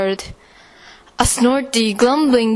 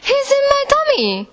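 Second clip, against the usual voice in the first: fast, much higher excited cry; faster than usual. A narrator's reading. Boy tells Mommy the beast is in his tummy, high and fast. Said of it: "I cried".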